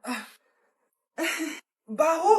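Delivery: ordinary talk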